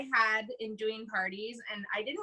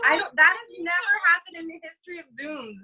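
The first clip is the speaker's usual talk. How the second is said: excited outburst; 5 semitones higher, 6 dB louder than usual. Real, not acted. Relieved outburst after mic fails.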